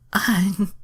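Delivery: high, loud mock moan; hammed-up high moan. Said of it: "Starfire moaned".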